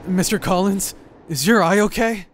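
high, loud, worried call; alarmed concern. Acted. Companion spotting an injured eye, loud alarmed concern. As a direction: call out in sudden alarm for someone hurt.

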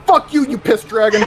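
triumphant cursing. Real, not acted. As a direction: swear at the dragon in heated triumph. Cursing the dragon after victory.

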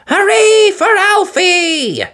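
very high, slow cheer. Acted. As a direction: cheer him on. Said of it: "Thomas cheered".

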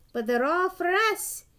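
insistent objection to an official; exclaiming. A narrator's reading. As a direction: exclaim it, pushing up in pitch and volume. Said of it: "exclaimed Douglas".

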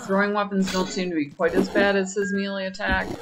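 ordinary delivery

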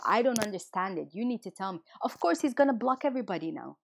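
ordinary talk, nothing marked

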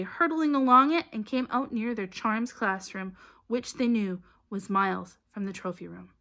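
usual speaking voice

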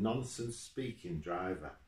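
ordinary delivery, nothing marked

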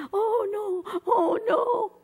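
high, wailing mock-cry of a mother; crying mom. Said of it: "Kenny's like, she's crying".

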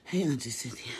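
usual speaking voice

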